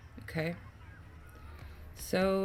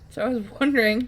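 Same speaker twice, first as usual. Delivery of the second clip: laughing; 4 semitones higher, 8 dB louder, faster than usual. Real, not acted. Laughing while explaining the skip.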